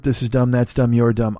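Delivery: ordinary delivery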